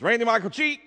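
high, quick full-name summons; disciplining parent. Retold or imitated. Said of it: "when they were disciplining me".

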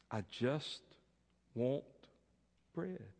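soft, halting plea with pauses; quiet beggar. Acted. Beggar answering the proud baker, soft and halting. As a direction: say it small and tired.

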